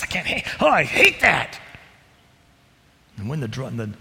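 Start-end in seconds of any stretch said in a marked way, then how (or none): 0.0-1.6 breaks off, then a louder frustrated cry; Paul's frustration. Acted. Preacher as Paul catching himself, exasperated. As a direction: catch yourself, then blurt it out. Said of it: "he says".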